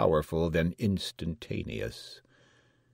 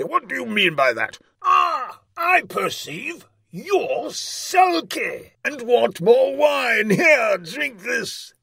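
very high, loud rage, slowing heavily; fierce tyrant; 9 dB louder, slower than usual. A narrator's reading. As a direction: rage at the jester, then drag out the accusation. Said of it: "cried the tyrant fiercely".

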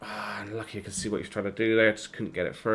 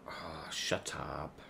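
unvoiced, slow whisper; whispered grumble; 6 semitones lower, 16 dB quieter, much slower than usual. Real, not acted. Gamer muttering at the game, whispered. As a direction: hiss it under the breath.

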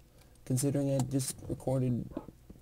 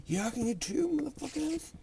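gleeful cheer; 13 semitones higher than usual. Real, not acted. Gleeful at extra iron.

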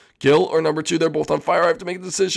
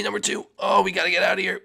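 ordinary talk

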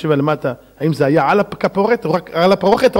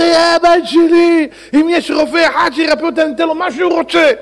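high-pitched, hammed-up wail; mock weeping; 11 semitones higher, 3 dB louder than usual. King begging for a doctor for his daughter, high mock wailing. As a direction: plead in an exaggerated crying voice. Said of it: "והמלך בוכה".

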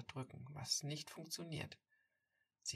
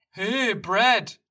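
loud, high Hey, Brad called from afar; loud call of Hey, Brad from a buddy; 7 semitones higher, 20 dB louder than usual. Acted. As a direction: shout Hey, Brad across the park. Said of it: "Plötzlich ruft jemand".

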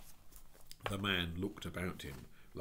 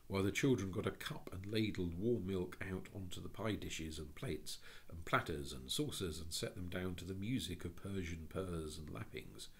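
usual speaking voice

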